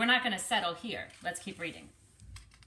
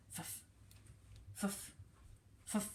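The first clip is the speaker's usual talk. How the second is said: soft dust puffs; 13 dB quieter than usual. Shown as a demonstration. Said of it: "it says".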